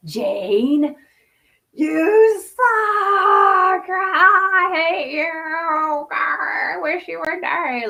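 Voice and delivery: loud, high, cruel inner voice, drawn out on I hate you; harsh inner critic. Retold or imitated. Inner critic berating Jane, loud and cruel. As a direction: spit it at yourself like a bully. Voicing her abusive inner voice.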